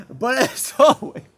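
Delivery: outro line broken by laughter; laughing. Real, not acted. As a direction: laugh through the words. Laughing through outro retry.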